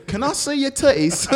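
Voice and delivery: high-pitched hammed-up British request; cheeky fake Brit, loud and high; mock British accent. Playing a Brit abroad for laughs, high mock British. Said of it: "I'm a Brit".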